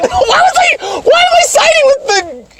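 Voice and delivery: high, laughing exclamation; laughing, high-pitched exasperation; exasperated outburst. Real, not acted. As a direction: burst out in shrill disbelief. Exasperated outburst at own retelling.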